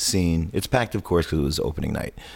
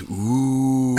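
long, higher "oooh" of a crowd; imitated crowd "ooh"; 5 semitones higher than usual. Whole audience reacting to the friend's threat, imitated crowd ooh. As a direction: draw out a jeering audience ooh. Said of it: "the whole audience goes".

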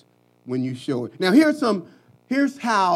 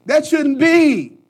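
slow, loud, high declaration; emphatic shout; 8 semitones higher, 9 dB louder, slower than usual. Real, not acted. Preacher shouting to the congregation, slow and loud. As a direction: preach it out, hammering each word.

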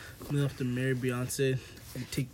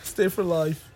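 loud mock judge, breaking into laughter; high, loud joke ruling through laughs; judge's ruling; 6 semitones higher, 14 dB louder than usual. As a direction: blurt out a hammed-up verdict. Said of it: "The judge is gonna, like, just".

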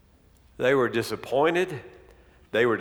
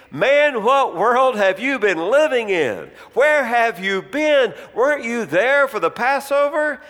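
loud, fast and incredulous, higher pitch; a disbelieving disciple calling out to a stranger; incredulous Cleopas; 5 semitones higher, 5 dB louder than usual. Acted. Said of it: "answered and said to him".

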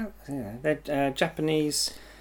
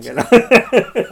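laughing; 6 semitones higher, 11 dB louder than usual. Real, not acted. Tipsy admission, laughing.

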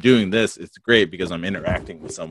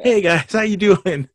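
much higher, louder, slow showman greeting; big podcast intro voice; 14 semitones higher, 9 dB louder than usual. Shown as a demonstration. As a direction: ham up the host welcome. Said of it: "Oh, crap, I forgot what I was gonna say".

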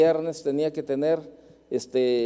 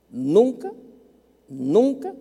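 nunca, nunca punched high and loud; stress on nunca; 6 semitones higher, 5 dB louder, slower than usual. Acted. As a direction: hammer each nunca, rising. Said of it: "nunca ha llegado tarde".

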